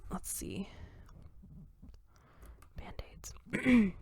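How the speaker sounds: whispered under the breath while looking something up; whisper to self. Real, not acted. Vlogger hunting for the Band-Aids poem, whispering to herself. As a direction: whisper it to yourself as you search.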